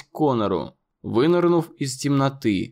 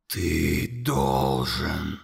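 very low, slow, whispered monster voice; 10 semitones lower, 5 dB quieter, much slower than usual. A narrator's reading. Monster whispering accusations to Conor, low and slow. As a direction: loom over the boy and breathe every word.

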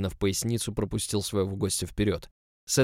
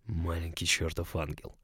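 slow, soft, intimate murmur; hushed murmur; 3 semitones lower, 8 dB quieter than usual. A narrator's reading. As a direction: draw it out under your breath. Lover murmurs to the narrator. (медленно, тихо, вполголоса)

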